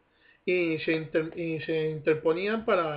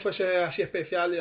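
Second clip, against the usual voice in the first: ordinary talk, nothing marked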